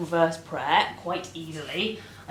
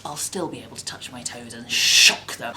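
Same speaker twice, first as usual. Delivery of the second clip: normal talking voice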